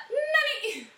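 high, loud cooing single call; dad calling Nani. Retold or imitated. Dad opening the door for mom, high cooing. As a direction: sing out the name as the door opens. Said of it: "Él abre la puerta".

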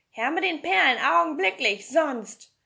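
loud, high-pitched threatening order; angry demand. A narrator's reading. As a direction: demand it at once, loudly. Villain barks a demand for pearls.

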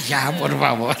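low, different voice begging them to stop; mock pleading. Host to laughing crowd, low mock pleading voice.

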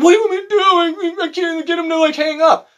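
very high, loud mock panic; frantic coworker. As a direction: whine it like a desperate newbie. Coworker pleads about the caller.

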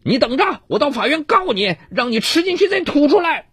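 high, seething threat; through gritted teeth. A narrator's reading. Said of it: "我咬牙切齿地说".

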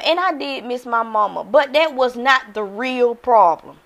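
ordinary talk, nothing marked

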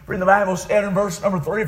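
ordinary talk

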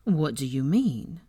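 slow, mock-hurt question; pained tone. A narrator's reading. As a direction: play wounded, drawing it out. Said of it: "he adopted a pained tone".

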